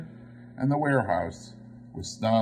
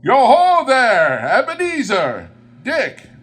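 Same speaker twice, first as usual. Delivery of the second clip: much higher and louder, a rich hearty call; jovial Fezziwig voice; 13 semitones higher, 10 dB louder than usual. A narrator's reading. Old master calling his apprentices, big jovial hail. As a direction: boom it out like a fat, merry old master. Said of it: "comfortable, oily, rich, fat, jovial voice".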